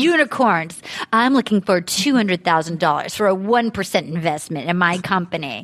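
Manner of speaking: low, deadpan business pitch; mock investor pitch. Host pretending to pitch to investors on a show, mock-serious low voice.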